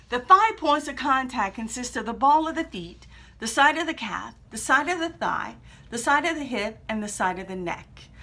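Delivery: ordinary talk, nothing marked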